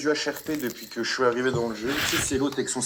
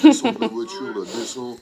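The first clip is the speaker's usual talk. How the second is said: extremely deep bass voice; 7 dB louder than usual. Pretend. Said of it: "tu sais faire la voix grave comme ça".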